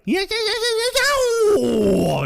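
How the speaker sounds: very high, drawn-out yipping howl; high yips falling from a long peak; imitated whoop. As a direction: whoop wildly like the movie character. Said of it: "he's like".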